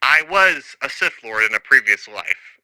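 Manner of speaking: ordinary delivery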